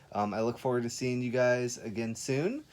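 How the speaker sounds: ordinary talk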